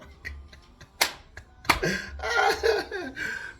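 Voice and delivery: high, loud burst of laughter; helpless laugh. Real, not acted. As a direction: crack up mid-story, high and loud. Cracks up telling the story.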